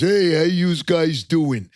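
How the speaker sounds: mock Staten Island tough guy; New York caricature. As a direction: play the cartoon New Yorker greeting. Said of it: "New York caricatures".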